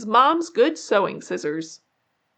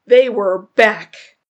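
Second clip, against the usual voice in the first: loud, slow ominous announcement; spooky story reveal; dramatic reveal; 8 dB louder, slower than usual. A narrator's reading. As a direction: hit the scare line hard.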